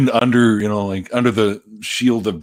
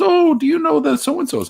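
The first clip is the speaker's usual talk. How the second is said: high mock little-lady voice; lightly hammed-up imitation of the woman in Rome; imitating the lady; 4 dB louder than usual. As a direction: chirp it like an eager old lady. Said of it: "She goes".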